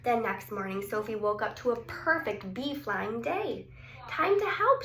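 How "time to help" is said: put-on high, loud cheerful child voice; little girl ready to help; eager girl. A narrator's reading. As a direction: chirp it like an eager helper. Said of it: "she said".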